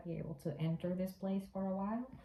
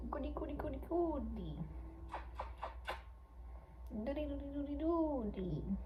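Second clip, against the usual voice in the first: cooing; 6 semitones higher, 7 dB quieter than usual. Acted. Cooing nonsense to cat.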